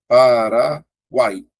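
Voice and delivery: "Paraguai" slowly broken up, "guai" kept whole; slow teacher's breakdown of "Paraguai"; syllable by syllable. Shown as a demonstration. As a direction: stretch out "Paraguai" and set "guai" apart. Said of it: "Vou fazer aqui".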